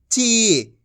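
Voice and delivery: ची with a long, sustained ee; drawn-out long ee. Shown as a demonstration. Teacher models the syllable for learners, vowel drawn out. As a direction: hold the ee in ची. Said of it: "खींच खींच के पढ़ेंगे".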